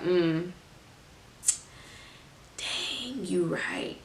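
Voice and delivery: breathy whispered concession, almost to yourself; a sheepish whispered "dang, you right"; grudging whisper. Retold or imitated. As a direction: admit it under your breath. Conceding quietly after being corrected.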